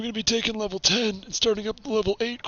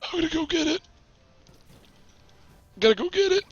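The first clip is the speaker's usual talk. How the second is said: put-on raspy old geezer rambling; old man voice; 5 semitones higher, much faster than usual. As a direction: play a grumpy old man. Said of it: "trying to keep the old man voice".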